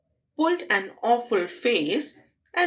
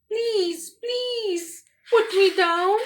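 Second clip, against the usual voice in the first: put-on much higher pleading girl; desperate begging; 11 semitones higher, slower than usual. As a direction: beg it while dangling up high. Girl begs monster.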